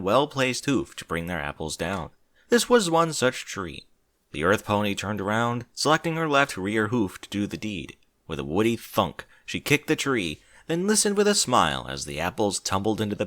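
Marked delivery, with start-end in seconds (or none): none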